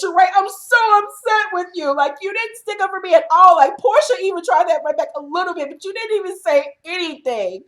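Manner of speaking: high, put-on whiny upset voice; whiny Kim. Kim whining to Sheree that she didn't back her up. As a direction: pitch it up and whine like she's hurt. Voicing Kim's complaint to Sheree.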